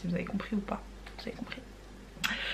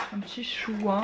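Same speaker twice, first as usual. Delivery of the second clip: normal talking voice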